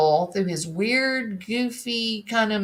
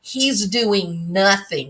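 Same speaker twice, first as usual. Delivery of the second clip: usual speaking voice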